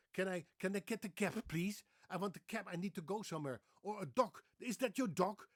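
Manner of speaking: put-on high, loud Dutch-accented English; exaggerated Dutch English, hard final consonants. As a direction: play a Dutch speaker mangling English vowels. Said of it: "They say cab".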